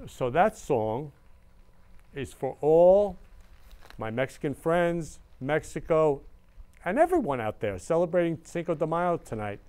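ordinary talk

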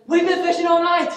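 very high, loud mock protest; exasperated shout. Peter protesting to Jesus after a fruitless night, exasperated shout. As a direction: yell it up high in indignant disbelief.

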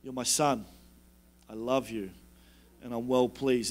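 gentle fatherly affirmation; God's voice. Retold or imitated. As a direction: murmur it like a loving father. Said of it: "is God telling him".

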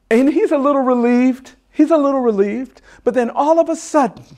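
high, loud storytelling; excited suspense. A narrator's reading. Storyteller builds to the other lion appearing, excited and loud. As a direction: push it up high and loud toward the surprise.

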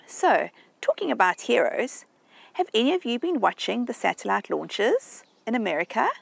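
ordinary delivery

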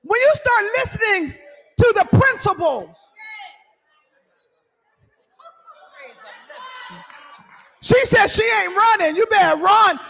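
loud, high preacher's call to the congregation; preaching at full force. Acted. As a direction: preach it out, raising the roof.

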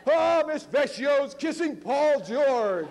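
put-on high, slow singsong kids' taunt; mock the classmate like jeering children; taunting kids.

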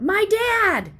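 a high, loud blurt from the back of the class; a kid blurting a shout in class. Acted. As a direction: yell it out without raising a hand. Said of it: "A voice shouted out".

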